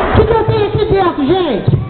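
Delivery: put-on kids party magician. Magician addressing the audience.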